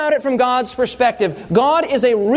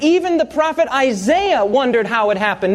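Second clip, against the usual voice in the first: ordinary talk, nothing marked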